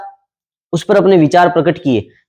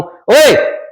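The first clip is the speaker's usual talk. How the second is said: sudden high loud "ओए"; loud call; 6 semitones higher than usual. Shown as a demonstration. Teacher demonstrating loud speech to students, a sharp call. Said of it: "तेज बोल रहा हूं".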